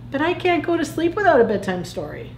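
lightly voiced pleading Plankton. Plankton begs Crabby for a story, mildly pleading. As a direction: plead lightly, starting high and falling.

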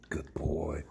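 hushed whispered praise; whispering. Real, not acted. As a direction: whisper it to the dog.

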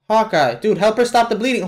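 ordinary talk, nothing marked

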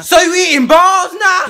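high, loud yelling father; angry dad shout. Acted. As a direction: yell it like an outraged dad. Dad yells at kid.

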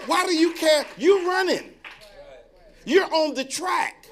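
high, loud preacher shout; full-force preaching. Acted. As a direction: drive it home to the congregation.

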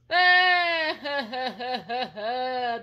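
high, loud fake wail; loud wailing cry. Puppet Dominique wails because his son is denied, loud fake sobbing. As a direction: burst into a hammed-up wail.

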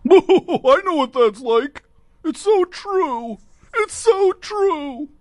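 high, slow falsetto sarcasm; mock falsetto. Host mocking a rich guest's sailing story in high, slow falsetto. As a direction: squeal it like a fake sympathizer.

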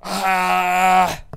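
loud, high, sustained yell; long scream. Real, not acted. Gamer after losing the fight, long scream. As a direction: hold one long "aah" scream.

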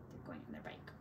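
breathy aside; whisper. Real, not acted. Reader to children, pointing at the bike: whispered aside. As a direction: murmur it over the picture.

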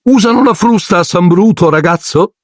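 very high, bossy barked question; high mock bark; Aunt Marge barking. A narrator's reading. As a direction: bark it at the boy, pitched very high. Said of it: "abbaiò".

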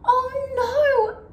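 high, drawn-out elephant wail; storybook distress, pitched high; acted dismay. A narrator's reading. Elephant discovers stretched nose.

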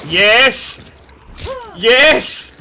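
cheering a teammate through a tense fight, loud and high; excited cheering. Real, not acted. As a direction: cheer him on at full volume.

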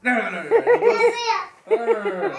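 high laughing no. Real, not acted. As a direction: giggle the no's. Laughing no to the baby.

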